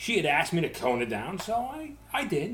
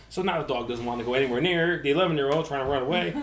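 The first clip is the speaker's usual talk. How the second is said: usual speaking voice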